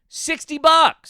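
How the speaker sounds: sixty bucks stretched slow, high and loud; drawn-out incredulous sixty bucks; emphatic outrage. Real, not acted. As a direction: land "sixty bucks" as the shocking punchline. Punchline about at-cost price.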